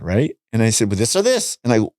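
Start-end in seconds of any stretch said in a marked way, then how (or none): 0.9-1.6 high, pushy; impatient, getting loud; raised voice at wife. Retold or imitated. Snapping at his wife over which sweater, getting loud. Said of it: "I kinda got my voice a little bit loud".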